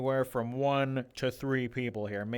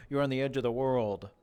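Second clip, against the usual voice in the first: ordinary talk, nothing marked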